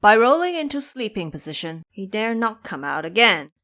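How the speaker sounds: ordinary delivery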